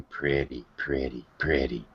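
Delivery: low gravelly creep murmuring softly. Retold or imitated. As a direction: rasp it out low and soft, like a creep in the dark. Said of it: "fairly gravelly voice going".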